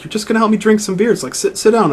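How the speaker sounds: a hint of an easygoing soldier; imitating Caden. Retelling Caden inviting Shepard for beer, lightly voiced. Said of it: "He's like".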